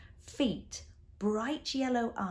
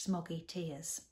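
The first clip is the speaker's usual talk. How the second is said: usual speaking voice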